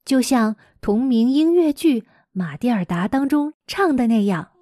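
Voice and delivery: ordinary delivery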